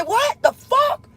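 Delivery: high, slow, stunned "What the fuck?"; shocked outburst. Retold or imitated. Mother on the phone hearing her car is gone, shocked and high. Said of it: "I'm aggravated now".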